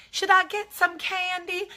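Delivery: high-pitched mock film character; movie role-play. Vlogger playing Shelby's helper in a film scene, high mock voice. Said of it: "I could do the whole movie".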